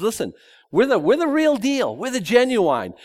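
high smug bragging voice; mock boast. Preacher imitating zealots who claim to be real, smug and high. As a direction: brag it like you're the genuine article.

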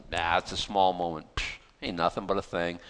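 low, soft shrug of a voice; casual dismissal. Acted. Preacher voicing God to the congregation, casually dismissive. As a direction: brush it off quietly, as if it were trivial. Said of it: "God, God says".

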